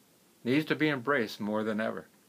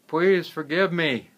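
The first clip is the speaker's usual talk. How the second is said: high, loud, slow plea for forgiveness; pleading; 6 semitones higher, 7 dB louder than usual. Acted. Gardener begging the wealthy man from a cave, pleading. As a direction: beg out loud, drawing it out.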